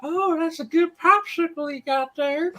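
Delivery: put-on very high creepy old-man voice; high creepy cartoon pervert voice to a coworker; Herbert the Pervert impression. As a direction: leer at him in a high creepy lilt. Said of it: "the impersonation of it".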